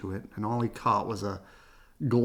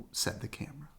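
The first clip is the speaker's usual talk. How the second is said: whispering; 4 semitones higher, 9 dB quieter, faster than usual. Acted. Whispered narration.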